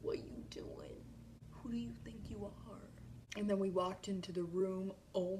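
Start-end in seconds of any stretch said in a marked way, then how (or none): 0.0-2.9 stunned whisper. Retold or imitated. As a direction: whisper in disbelief. Starstruck seeing Harry.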